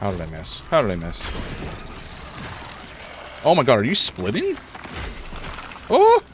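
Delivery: usual speaking voice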